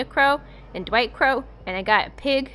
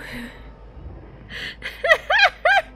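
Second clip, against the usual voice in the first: high shrieking laugh. Real, not acted. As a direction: burst out laughing. Laughing at own dream.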